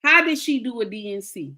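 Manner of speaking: indignant demand with emphatic bursts; retold outrage. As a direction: replay your disbelief, pausing between outbursts. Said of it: "I was going crazy".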